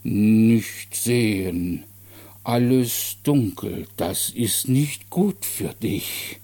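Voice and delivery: very slow, drawn-out booming voice; booming simple-minded man. Acted. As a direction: speak haltingly, each word stretched and weighty. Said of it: "sein dröhnender Bass". (dröhnend, schwerfällig und sehr langsam)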